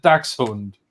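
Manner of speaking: Dachshund said the German way, DAKS-hoont; German pronunciation. Shown as a demonstration. Streamer showing how Dachshund sounds in German, German pronunciation. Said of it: "in German, I pronounce it".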